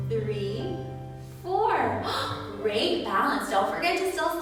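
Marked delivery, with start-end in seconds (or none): 2.1-2.6 high breathy gasp of delight. Acted. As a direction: gasp as if amazed. Teacher gasps in delight.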